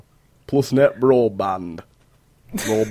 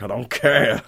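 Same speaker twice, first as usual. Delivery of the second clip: dismissive; 5 dB louder than usual. Retold or imitated. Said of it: "I'll be like".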